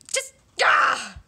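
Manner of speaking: high loud scream of frustration; frustrated scream. Acted. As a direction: let out a frustrated shriek. Frustration explodes.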